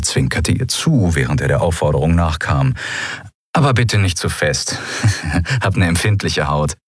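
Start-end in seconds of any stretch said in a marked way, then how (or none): 3.5-6.8 playful plea with a chuckle; teasing chuckle. A narrator's reading. Captive winking at the guard who cuffs him, teasing chuckle. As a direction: chuckle lightly midway through the plea.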